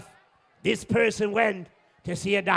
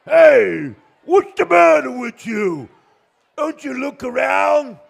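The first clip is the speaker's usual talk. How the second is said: loud, high indignant yell; angry driver's shout; 6 semitones higher, 10 dB louder, slower than usual. Acted. Driver yelling at the man on the road, angry shout. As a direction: holler at a careless driver.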